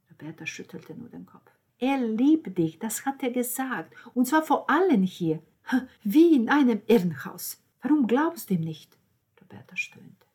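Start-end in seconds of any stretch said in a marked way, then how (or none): 1.8-9.0 high, insistent reasoning; exasperated appeal. Acted. Nanina urges Roberta to believe him, exasperated. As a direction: argue forcefully, pressing for an answer.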